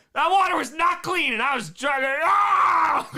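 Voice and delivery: loud, high outburst ending in a yell; excited exclamation. Real, not acted. Exclaiming over dirty water.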